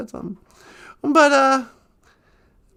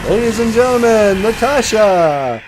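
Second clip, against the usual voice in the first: loud, slow show announcer; announcer intro; 4 semitones lower, 7 dB louder than usual. Pretend. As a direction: build up the host's entrance.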